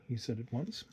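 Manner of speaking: usual speaking voice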